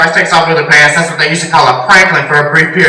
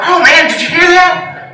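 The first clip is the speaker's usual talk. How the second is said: much higher, slow, awed hush; excited ghost hunter; 10 semitones higher than usual. Retold or imitated. Ghost hunter hearing a recording, high awed mock voice. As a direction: gasp like a spooked TV ghost hunter. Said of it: "I'm like".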